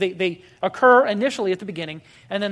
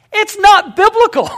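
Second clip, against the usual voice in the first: high and much louder, punched out; emphatic exclamation; 12 semitones higher, 10 dB louder than usual. Real, not acted. Preacher declaring to his congregation, loud and emphatic. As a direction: slam the point home with full force.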